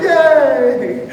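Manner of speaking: high, drawn-out mock "yay"; kids cheering. Kids seeing their teacher picked as the bear, high mock cheer. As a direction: imitate a crowd of thrilled kids.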